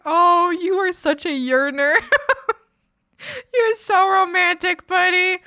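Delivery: big sister laughing at her romantic brother; laughing teasing. Real, not acted. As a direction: tease him fondly through laughter.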